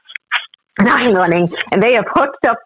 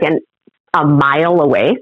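usual speaking voice

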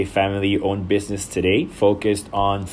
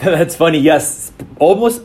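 laughing as he speaks, higher and louder; 8 semitones higher, 7 dB louder than usual. Real, not acted. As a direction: let a real laugh carry the words. Laughing at interviewer's joke.

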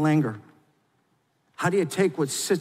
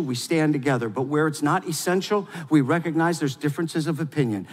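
normal talking voice

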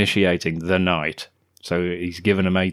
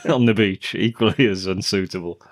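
ordinary talk, nothing marked

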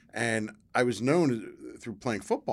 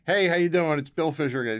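loud, raised, upbeat imitation of a caller; hearty phone greeting; 7 semitones higher, 7 dB louder than usual. Actor phoning the SAG office yet again, hearty greeting. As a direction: call out a familiar hello, starting high.

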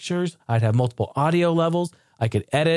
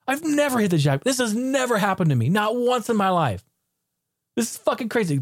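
higher, breathless disbelief at the win; excited disbelief; 8 semitones higher than usual. Retold or imitated. Vlogger reliving his first jackpot, excited disbelief.

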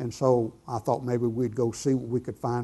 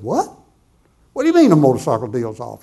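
startled "What?" jumping up, then loud demand; incredulous retold question; taken aback; 6 dB louder than usual. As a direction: act out disbelief at bad news. Acts out his startled reply to news.

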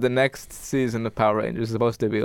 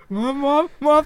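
put-on much higher, whiny kid calling mom; mock kid whimper; 3 dB louder, slower than usual. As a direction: tease him by squeaking for mom. Mocking friend's childhood panic.

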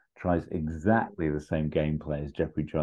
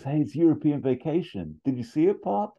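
eager son; 10 semitones higher than usual. Retold or imitated. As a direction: blurt it out like a keen kid to his dad.

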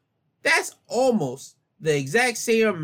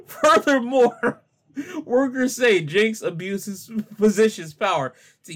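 high, loud start, settling as it goes; fighting laughter; 4 semitones higher, 3 dB louder than usual. Real, not acted. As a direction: push on reading while cracking up. Reading on while still laughing.

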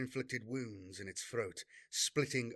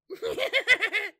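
high, loud hideous giggle; shrill childlike giggle from a demon; 12 dB louder than usual. A narrator's reading. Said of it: "high-pitched, almost childlike giggle".

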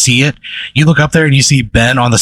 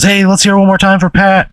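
high-pitched rallying announcer call; calling to crowd; 9 semitones higher than usual. Acted. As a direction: rouse the audience to cheer him on. Bandleader rallies the crowd for Pat.

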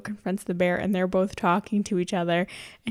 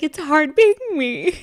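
higher, louder diva moan; dramatic bear lament; 8 semitones higher, 8 dB louder than usual. Retold or imitated. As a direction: whine it like a put-upon diva. Mama bear complaining.

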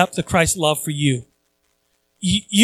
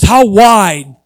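"how wide" with the word "wide" swelled out much louder; emphatic; 8 dB louder than usual. Real, not acted. Preacher stressing the width of Christ's love, loud. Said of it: "how deep".

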